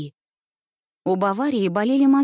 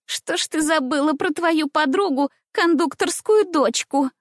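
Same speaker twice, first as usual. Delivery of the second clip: put-on much higher, taunting girl's voice; mocking little voice; 8 semitones higher than usual. A narrator's reading. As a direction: taunt her in a high little voice. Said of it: "прозвучал насмешливый голосок Ниночки". (насмешливый тонкий голосок)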